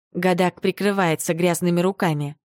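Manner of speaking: usual speaking voice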